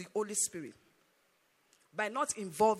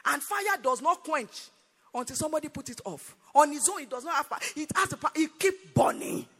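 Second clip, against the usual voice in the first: loud, raised-pitch sermon delivery; forceful preaching; 4 semitones higher, 7 dB louder than usual. Real, not acted. Preacher urging congregation on the Spirit's power, forceful preaching. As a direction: drive each point home loudly, stretching key words.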